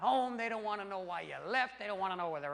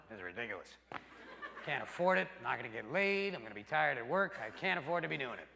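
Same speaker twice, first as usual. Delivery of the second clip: lower, quieter grumbling to himself; the brain complaining; 7 semitones lower, 4 dB quieter than usual. Pretend. The brain in the stripper club complaining, quiet grumble. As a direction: mutter like a sensible brain. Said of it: "The brain's in the stripper club going".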